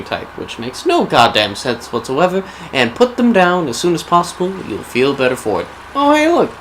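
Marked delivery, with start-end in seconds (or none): 5.9-6.5 high-pitched sudden discovery; bright little jump of surprise; pleasantly surprised. Real, not acted. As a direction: perk up high at spotting something. Suddenly spots something in the game.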